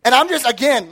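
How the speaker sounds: ordinary talk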